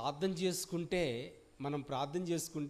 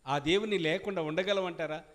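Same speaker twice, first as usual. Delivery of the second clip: ordinary talk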